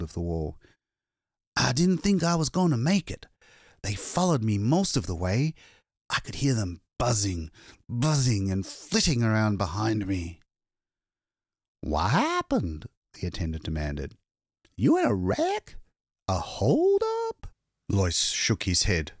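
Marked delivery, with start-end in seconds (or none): 1.6-10.4 higher, frightened rush of words; a hunted man finally safe, still shaking; shaken exhausted man. Acted. Lois tells the attendant of his escape, frightened. 11.8-17.5 a gas station man sizing up a stranger; suspicious attendant. Acted. Attendant questions ragged stranger, suspicious and wary.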